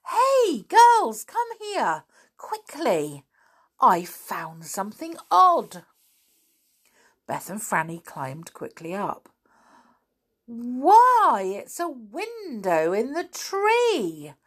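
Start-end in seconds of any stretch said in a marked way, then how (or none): 0.1-5.9 high excited shout; calling out. Acted. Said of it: "Suddenly he gave a shout". 10.5-14.3 high, slow amazed gasp; astonished. Acted. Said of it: "said Beth in astonishment".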